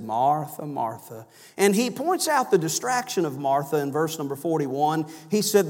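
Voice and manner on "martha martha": very calm. Retold or imitated. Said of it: "Jesus just very calmly said".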